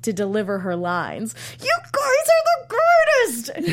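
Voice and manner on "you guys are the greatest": high, slow, strained fake enthusiasm; forced cheer through gritted teeth. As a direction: sell it through a clenched smile. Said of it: "smiling through gritted teeth".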